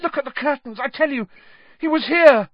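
high panicked voice, building to loud; frantic insistence. Acted. Ron insists to doubting Dean, frantic and high. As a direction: plead to be believed, climbing to a cry.

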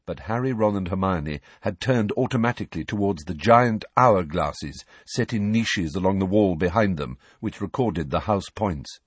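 usual speaking voice

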